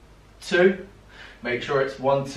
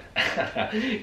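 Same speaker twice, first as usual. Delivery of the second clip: breathless laugh; 9 semitones higher, 4 dB quieter than usual. Real, not acted. Laugh after abs burning.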